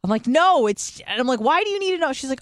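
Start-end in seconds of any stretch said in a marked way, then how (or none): 0.3-1.0 high, loud cut-off protest; retold yelling. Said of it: "So I'm, like, yelling at her". 1.4-2.1 high-pitched indignant challenge; retold yelling. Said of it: "So I'm, like, yelling at her".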